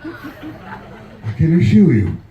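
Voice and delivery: usual speaking voice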